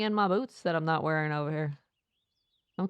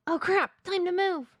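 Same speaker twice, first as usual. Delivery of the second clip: high, urgent character voice; Johnny; 13 semitones higher than usual. Acted. Johnny urges escape, high alarmed voice. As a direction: blurt it in pitched-up alarm.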